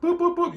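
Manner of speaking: high beeping mimic of a phone buzzer; playful beep beep. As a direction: chirp it like an alarm going off. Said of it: "my little buzzer just went off".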